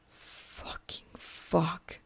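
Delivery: slow, unvoiced whispered curse; a whispered, dragged-out fucking fuck; muttered curse. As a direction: breathe the curse out under your breath, slowly. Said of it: "he mutters".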